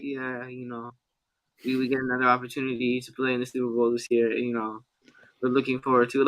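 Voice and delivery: low, soft, unexcited athlete answer; downplay it like a laid-back player at the podium; chill Nick Bosa. Retold or imitated. Podcast host mimics player answering reporter about Super Bowl, low-key and chill. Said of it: "always that chill demeanor".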